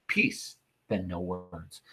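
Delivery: hushed command. Acted. Brutus softly silencing Cletus after his refusal, slow hush. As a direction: quietly shut the talk down.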